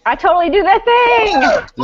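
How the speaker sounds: high, slow triumphant cheer; excited. Real, not acted. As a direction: celebrate the win.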